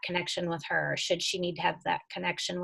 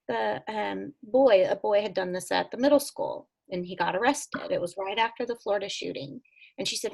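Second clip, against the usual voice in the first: ordinary talk, nothing marked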